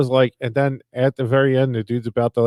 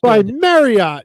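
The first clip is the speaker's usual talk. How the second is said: higher, louder, slow salesy tag; mock robocall ad; 10 semitones higher, 7 dB louder, much slower than usual. Robocall naming its sponsor, mock salesy slow. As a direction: stretch it like an ad.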